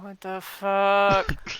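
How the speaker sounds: long, drawn-out mock cursing; imitating a stunned, slow-motion swear; exasperated disbelief. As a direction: stretch it out slowly, swelling on the last word. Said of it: "standing at the end of the door going".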